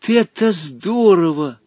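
a touch of glee, slow; lightly played delight; overjoyed. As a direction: cheer at a clever trick. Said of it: "обрадовался Волька".

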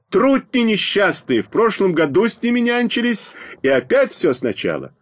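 exasperated high-voiced heckle; indignant outcry. A narrator's reading. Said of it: "но отдельные выкрики".

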